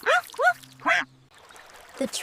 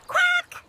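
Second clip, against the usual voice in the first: a sharp quack, much higher; duck quack. Acted. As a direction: quack like an excited little duck.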